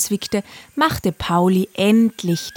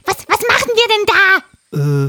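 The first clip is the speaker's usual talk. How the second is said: high, loud parrot voice; a frantic parrot urging; excited parrot; 6 dB louder than usual. Acted. Parrot voice held. (hohe, aufgeregte Papageienstimme)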